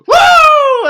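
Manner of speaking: high, loud celebratory whoop. Real, not acted. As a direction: whoop for joy.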